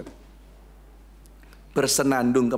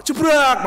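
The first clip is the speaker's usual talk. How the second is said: loud, higher crash sound jebrak; crash noise jebrak; 10 semitones higher, 7 dB louder than usual. Retold or imitated. Preacher telling a car crash story, loud crash noise jebrak. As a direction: bark out jebrak like a sudden collision.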